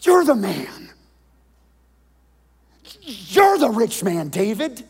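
loud, high, slow accusation; prophet's accusation. Acted. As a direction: point the finger and call him out. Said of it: "And Nathan says".

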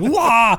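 short high mock ape roar; King Kong roar. Waking in a dumpster, roaring like King Kong. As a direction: bellow it out like a chest-pounding ape. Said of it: "pounds his chest like King Kong".

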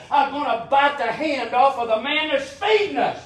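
high, rising preacher's cry; fiery preaching. Acted. Preacher scolds the congregation like mad dogs, fiery preaching. Preacher builds to full force.

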